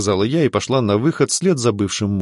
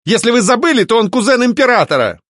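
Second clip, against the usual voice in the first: high, indignant outburst; agitated protest; 13 semitones higher, 4 dB louder than usual. A narrator's reading. Alfred threatens a complaint to the duke, agitated. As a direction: protest hotly, pitch way up. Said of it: "не успокаивался Альфред".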